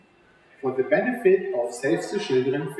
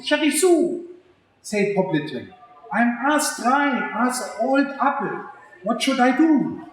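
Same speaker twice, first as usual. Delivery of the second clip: higher, pleading cartoon pig on the phone; worried pig voice; 8 semitones higher, 3 dB louder than usual. Poppleton phones Cherry Sue for help, higher and worried. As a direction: play Poppleton fretting to his friend.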